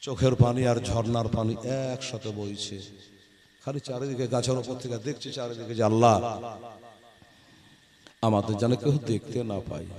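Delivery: hushed sad telling. A narrator's reading. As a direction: drop the voice to a sad hush. Sad story moment, voice drops. (নরম দুঃখী স্বরে)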